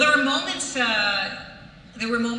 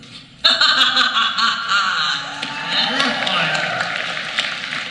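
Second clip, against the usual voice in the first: evil villain laugh; 5 dB louder than usual. Acted. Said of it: "And then Ray Vest was".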